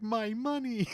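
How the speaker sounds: high, drawn-out mock wail; Howard losing it. Villain sees the dye bomb ruin his loot, mock hysterical. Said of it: "Howard completely loses it".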